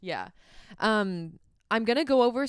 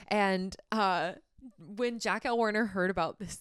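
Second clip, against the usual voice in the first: usual speaking voice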